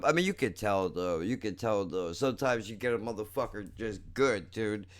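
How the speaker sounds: usual speaking voice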